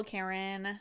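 normal talking voice

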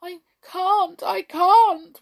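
high, loud helpless wail. A narrator's reading. Hop helpless before the king, wailing. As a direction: wail it, pitched right up. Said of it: "wailed Hop".

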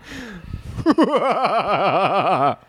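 long, high cackling villain laugh; unhinged evil laugh. Shown as a demonstration. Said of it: "can I do an evil laugh?".

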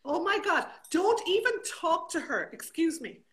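high, loud teasing indignation; play-scold a best friend at full volume; mock outrage. Mock tirade at late-arriving friend.

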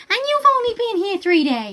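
high, incredulous telling-off; mock outrage. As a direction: play a kid appalled at a visiting uncle. Acting Scout's outraged retort.

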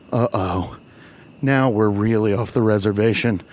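usual speaking voice

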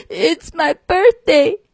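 high, slow tearful little voice; sobbing lamb. Acted. Lamb sobbing to the cow about its birthday. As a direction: sob out the words like a small lamb. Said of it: "sobbed the lamb".

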